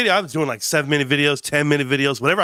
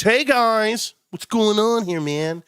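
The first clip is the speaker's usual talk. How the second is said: breezy, higher mock greeting; a guy strolling in, all chummy; mock friendly newcomer; 5 semitones higher, much slower than usual. Streamer mimics a Discord guy walking in, mock-friendly greeting. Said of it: "And then he walks".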